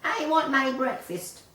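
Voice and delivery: high, loud rude demand; rude complaint. A narrator's reading. Chick demanding breakfast, rudely complaining. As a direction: whine it like a spoilt brat. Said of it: "rudely complaining".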